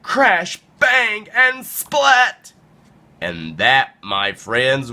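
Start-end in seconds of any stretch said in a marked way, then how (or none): 0.0-2.5 high, slow, punched-out impacts; theatrical storybook splat; dramatic crash words. A narrator's reading. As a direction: punch each impact word high and drawn-out.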